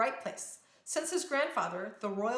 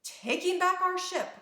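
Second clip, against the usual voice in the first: higher and louder, standing firm; defiant declaration; 9 semitones higher, 5 dB louder than usual. Acted. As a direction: claim the ship back with bold resolve.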